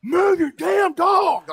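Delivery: very high, loud, drawn-out scream; furious yell. Retold or imitated. Owner screaming at a kid to protect her dog. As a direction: bellow it in a panic. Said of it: "I just yelled at this kid".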